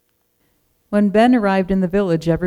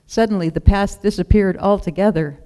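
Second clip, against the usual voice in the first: ordinary delivery, nothing marked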